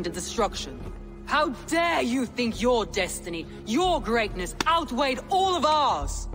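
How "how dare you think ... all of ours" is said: indignant, pitch climbing to the last words; outraged rebuke. Acted. Leader condemning the follower who betrayed her, outraged rebuke. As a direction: build to a high, furious accusation.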